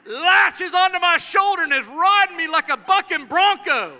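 slow, high, loud full-force telling; hammed-up horror, belted out; dramatic preaching. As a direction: stretch each phrase out like a roaring preacher. Dramatizes anger seizing him.

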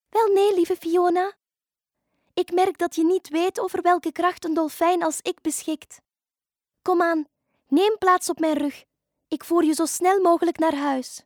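high, projected dolphin voice; confident dolphin. Acted. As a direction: reassure her proudly as a dolphin. (zelfverzekerde, hoge dolfijnenstem)

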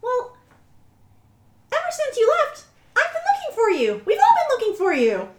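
high squeaky mouse voice, overjoyed; tiny squeaky mouse. Acted. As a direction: squeak it out like a happy little mouse. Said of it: "I'll do my best mousy voice".

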